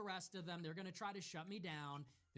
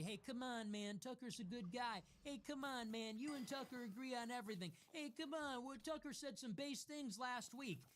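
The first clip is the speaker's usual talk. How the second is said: a hint of a pleading fan, higher; whiny "come on, man" impression; lightly mocking the people who defend Tucker; 5 semitones higher than usual. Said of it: "People are always telling me".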